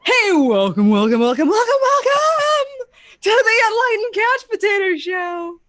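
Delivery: ordinary delivery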